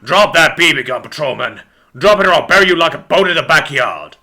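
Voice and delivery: loud police chief's order; stern detective barking commands; commanding. A narrator's reading. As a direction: order him to disarm with a threat. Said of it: "commanded Detective Deutschland".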